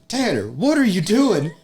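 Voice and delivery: high, loud, slow concerned buddy voice; mock friend voice. Imitating Jacoby finding him on the highway, high and slow. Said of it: "He goes".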